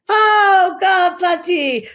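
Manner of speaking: high, loud swooning oh God; thrilled squeal. Real, not acted. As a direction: swoon out loud over the crush. Thrilled squeal over hot man.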